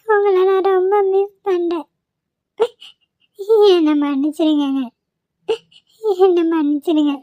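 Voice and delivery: sobbing confession with gasps between phrases; tearful wife pouring out her longing; crying. Acted. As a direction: plead through tears, breath catching. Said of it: "அழுவாதடி".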